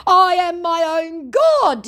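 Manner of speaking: high, slow, grandiose mock proclamation; profound declaration. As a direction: declare it like a self-made deity, mocking him. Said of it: "he declares very profoundly".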